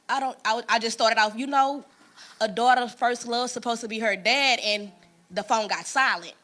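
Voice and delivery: normal talking voice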